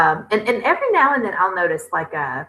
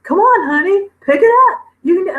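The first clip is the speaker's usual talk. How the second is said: much higher, louder sing-song coaxing; mock cheery husband; 10 semitones higher, 5 dB louder than usual. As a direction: ham up a peppy cheerleader husband. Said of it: "he's just like".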